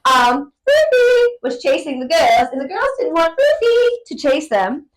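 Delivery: high, loud hammed-up British voice; British accent. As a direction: tell it like a posh Brit. Story told in British accent.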